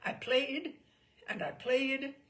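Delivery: plain reading voice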